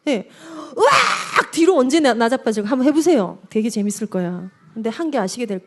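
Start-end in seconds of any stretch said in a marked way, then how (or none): 0.8-1.5 high-pitched mock tantrum yell; demonstrated tantrum scream. Shown as a demonstration. Showing parents a child throwing themselves backwards, mock tantrum scream. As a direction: let out a sudden acted shriek.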